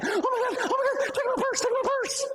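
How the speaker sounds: squealing high mock panic, laughing through it; hammed-up terrified victim, high and frantic. Preacher mimics the panicked victim the robber expected, shrill mock fright. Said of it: "expecting her to do is panic".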